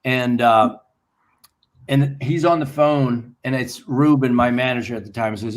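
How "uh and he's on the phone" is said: ordinary delivery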